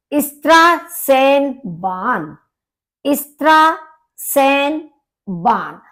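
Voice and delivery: इस्त्रासेनबान with ß said as sa, slow; German pronunciation. Shown as a demonstration. Teacher models the German word for students, slow and clear. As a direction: say इस्त्रासेनबान slowly, syllable by syllable. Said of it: "इसका pronunciation होता है सा".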